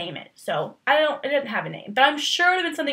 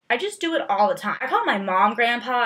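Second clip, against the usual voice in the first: ordinary talk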